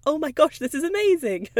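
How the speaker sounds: an overjoyed dog at the vet; excited dog. Pretend. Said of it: "the other that's like".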